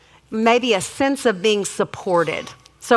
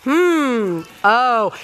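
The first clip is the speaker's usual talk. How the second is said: practiced mock surprise; much slower than usual. Shown as a demonstration. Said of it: "made us all sit there and practice".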